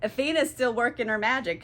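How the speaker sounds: ordinary talk, nothing marked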